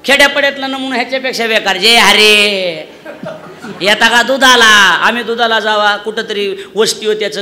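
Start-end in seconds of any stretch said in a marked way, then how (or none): 1.8-5.0 mock calling out. Villager calling at milk seller.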